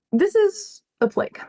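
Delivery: high, theatrical declaration; mock-grave warning; dramatic. Real, not acted. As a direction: overplay the doom on purpose. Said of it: "Not to be dramatic".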